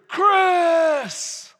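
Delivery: loud, high, drawn-out call; God calling out. Retold or imitated. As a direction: imitate a booming call from above. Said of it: "God has a Mexican accent".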